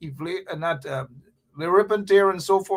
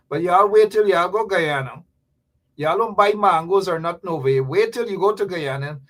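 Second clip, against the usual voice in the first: normal talking voice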